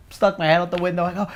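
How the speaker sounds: usual speaking voice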